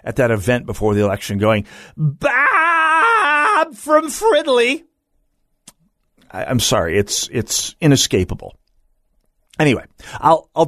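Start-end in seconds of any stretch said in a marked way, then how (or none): 2.0-4.8 very high, slow, drawn-out shouted name; Peggy Flanagan shout. Retold or imitated. Host recalls her campaign event, calling out high and slow. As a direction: stretch it out high like a rally cry. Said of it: "I just hear that voice".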